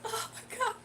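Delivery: high, soft teary gasp of words; mock overwhelmed. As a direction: sound moved to tears, playfully. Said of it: "And I'm just gonna cr".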